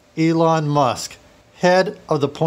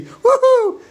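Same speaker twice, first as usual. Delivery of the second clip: loud, very high falsetto whoop; gleeful whoop; 7 dB louder than usual. Acted. Faux burglar delighted nobody will know him, gleeful whoop. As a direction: let out a piercing falsetto cheer. Villain celebrates his disguise.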